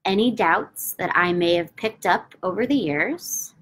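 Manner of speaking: slow, calm affirmation with long pauses; measured, soothing repetition; slow guided affirmation. Acted. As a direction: lead them through the tapping mantra gently. Tapping affirmation script, long pauses.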